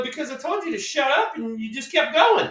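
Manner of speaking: high retold comeback; telling him off. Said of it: "And I go".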